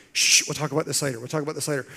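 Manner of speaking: hushed, brushing-off parent voice; shushing parent. Retold or imitated. Parent shushing a pestering child, lightly hushed. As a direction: shush the kid and put it off. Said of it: "You know how you do that with your kids".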